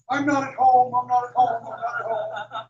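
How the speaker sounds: high squeaky critter voice, fading on the last; mock raccoon voice. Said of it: "it went".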